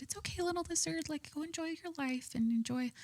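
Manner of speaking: high, soft cooing to a scared lizard; sweet baby talk. Retold or imitated. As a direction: coo gently like soothing a tiny pet. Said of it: "I was talking to him so sweet".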